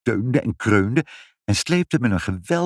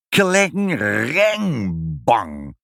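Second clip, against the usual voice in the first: crashing hammer noise; 3 semitones higher, much slower than usual. A narrator's reading. Father's hammer smashes the machine, imitated crash. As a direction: ring out the clangs slowly, drop on the bang.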